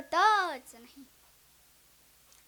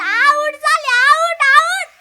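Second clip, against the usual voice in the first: high, loud complaining cry; mock loud wail; 8 semitones higher, 15 dB louder, slower than usual. Guest complaining to Baba, high loud mock wail. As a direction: wail it to the old man.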